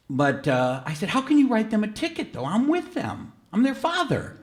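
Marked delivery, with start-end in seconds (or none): 1.1-4.4 high, pleading protest; indignant protest. Retold or imitated. Father protesting to the officer writing a ticket, high and indignant. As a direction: plead with disbelief, pitch up high.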